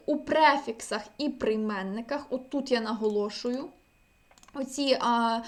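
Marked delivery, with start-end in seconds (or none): none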